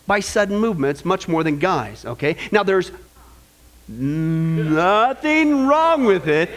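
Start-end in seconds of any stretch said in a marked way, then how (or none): 3.9-6.5 very slow, lower, stretched out; drawn-out catchphrase. Shown as a demonstration. Pastor cueing the congregation's catchphrase, low drawn-out chant. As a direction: hold each word for the crowd.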